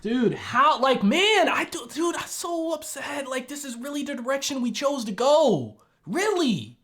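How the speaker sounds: high, pleading, incredulous complaint; upset disbelief. Real, not acted. Viewer upset at how the show ends, high incredulous complaint.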